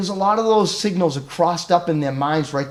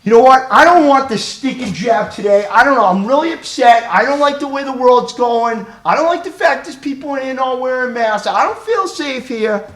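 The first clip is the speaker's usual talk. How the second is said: loud, high-pitched agitated rant; acted outburst from an upset man; upset complainer; 7 semitones higher, 6 dB louder than usual. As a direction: complain loudly, worked up. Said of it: "So the guy's like".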